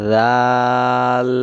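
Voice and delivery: teacher models জাল after the rule; model pronunciation. Shown as a demonstration. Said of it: "কেমন করে পড়তে হয়?".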